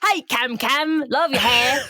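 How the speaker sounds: slow, drawn-out mock British accent; exaggerated British accent. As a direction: ham up the British accent, stretching the words. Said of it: "stop with the accent".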